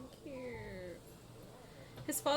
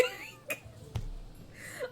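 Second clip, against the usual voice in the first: high breathless squeal-laugh; 7 dB quieter than usual. Real, not acted. Overwhelmed by cuteness.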